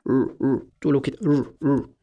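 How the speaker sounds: untrilled English ر, tongue pulled back. Shown as a demonstration. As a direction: curl the tongue back and hold ر. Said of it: "بترجع لسانك لورا". («ر» إنجليزي واللسان راجع لورا)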